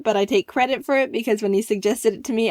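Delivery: normal talking voice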